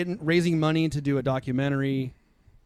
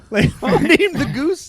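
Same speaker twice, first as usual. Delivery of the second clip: high, slow and breaking into laughter; laughing in disbelief at the name; laughing incredulous question; 13 semitones higher, 5 dB louder, much slower than usual. Real, not acted. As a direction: ask it while cracking up.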